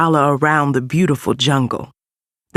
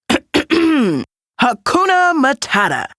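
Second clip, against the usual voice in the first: high, slow, drawn-out call of the motto; cheerful Timon voice; 10 semitones higher, slower than usual. Acted. Timon teaching Simba his motto, high and drawn-out. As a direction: sing it out like a carefree meerkat.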